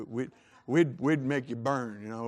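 ordinary talk

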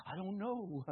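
soft, dropped-back voice of doubt; doubtful. Acted. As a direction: say it quietly, like an unsure believer.

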